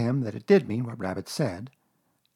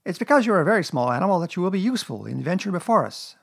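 Rabbit; 7 semitones higher, 5 dB louder, faster than usual. Acted.

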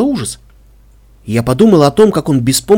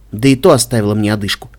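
ordinary delivery

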